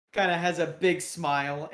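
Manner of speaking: ordinary talk, nothing marked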